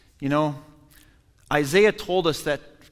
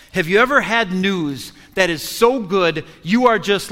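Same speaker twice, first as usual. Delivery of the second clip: normal talking voice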